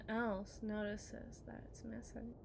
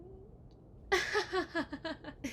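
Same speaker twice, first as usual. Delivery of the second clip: mocking laugh; 5 semitones higher than usual. Wife laughs mid taunt.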